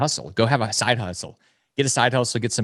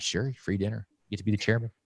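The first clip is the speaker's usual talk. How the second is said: softer, deadpan "Sure, free dinner"; dry quiet reply; 8 dB quieter than usual. Retold or imitated. Telling the dad he gets free dinners, in a dry quiet reply. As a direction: humor him, dryly.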